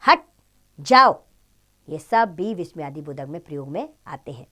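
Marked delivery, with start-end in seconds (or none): 0.1-1.2 commanding. Shown as a demonstration. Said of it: "क्रिया शब्द जैसे".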